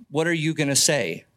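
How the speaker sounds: ordinary talk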